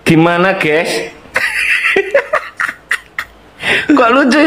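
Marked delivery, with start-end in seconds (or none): 1.3-2.9 high breathless giggle. Real, not acted. Laughing at confusing politics.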